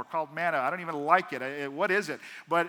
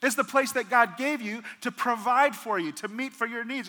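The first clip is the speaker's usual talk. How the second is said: ordinary talk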